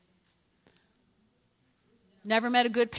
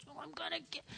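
very soft, higher mutter; hushed resolve; 4 semitones higher, 15 dB quieter, faster than usual. Acted. Woman telling herself she'll reach Jesus, hushed resolve. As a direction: say it under your breath, straining forward.

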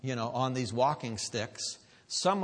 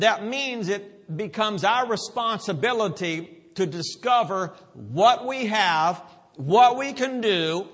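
louder preacher delivery, punching each verb; emphatic preaching; 6 semitones higher, 6 dB louder than usual. Real, not acted. Preacher explains delegated authority to church, emphatic preaching. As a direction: drive each phrase home.

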